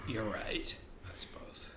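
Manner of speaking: barely voiced, whispered surrender; deflated concession. Real, not acted. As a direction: concede under your breath, very softly. Conceding after his idea is knocked down.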